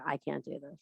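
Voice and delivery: a hushed, mock-hopeless I can't; imitating a doubter. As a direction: murmur it like a hopeless student. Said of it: "some of you are sitting there and going".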